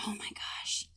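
breathy whisper, barely there; whispered to herself. Real, not acted. Contestant whispers to herself while puzzling over a quote, in a whisper. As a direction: whisper it under your breath.

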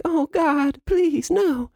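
very high, begging falsetto; mock terrified plea; pleading victim voice. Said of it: "playing it like".

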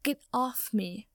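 mild, slow cry of protest. A narrator's reading. Draco trying to shove Potter off him, crying out. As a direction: cry out in understated exasperation. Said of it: "Draco cried".

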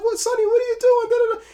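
very high, loud nagging wife; the wife badgering Sonny on the phone; imitating the wife. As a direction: squawk at him down the phone like a frantic wife. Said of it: "she's just like".

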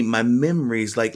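ordinary talk

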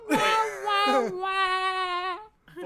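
high, loud mock wail of a rapper; [name] wailing. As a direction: cry out the wahs like a whiny rap hook. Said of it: "That's all I hear when he raps".

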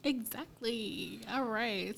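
ordinary talk

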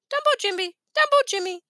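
high squawking parrot mimic. A narrator's reading. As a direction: parrot it back cheekily. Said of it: "parrot mimics".

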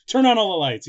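put-on high, loud excited child; childlike glee. Jake tapping everything in the forest, loud childlike glee. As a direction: demand it like a gleeful kid. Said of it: "He's like a child".

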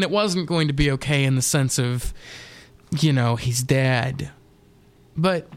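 ordinary talk

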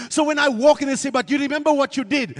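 loud, fervent preaching; preaching at full force. Acted.

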